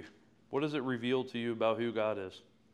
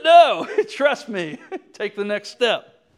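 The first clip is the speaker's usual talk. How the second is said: loud, high, slow acted insistence; hammed-up raised-voice reply; insistent comeback; 10 semitones higher, 16 dB louder, slower than usual. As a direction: argue back as God, laughing between phrases. Acts God arguing back, laughing.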